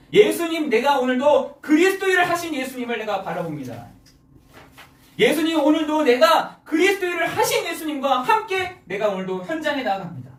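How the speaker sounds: high, forceful prayer declaration; impassioned pulpit prayer, easing at the end; fervent confession. Acted. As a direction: cry out your resolve to Jesus.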